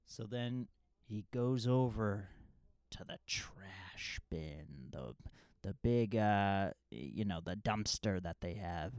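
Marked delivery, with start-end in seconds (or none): 2.9-5.1 slow hushed whisper. Real, not acted. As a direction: drop to a slow secret whisper. Suspense in retold scene.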